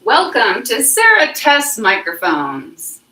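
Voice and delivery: slow, loud, singsong announcer welcome; loud show welcome. Shown as a demonstration. Host testing a new microphone, loud welcome. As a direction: belt out a drawn-out show intro.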